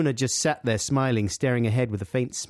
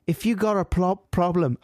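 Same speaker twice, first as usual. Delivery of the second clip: put-on higher-pitched, half-hearted Liverpool accent; attempted Liverpool accent; 5 semitones higher than usual. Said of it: "I'm gonna do a Liverpool accent".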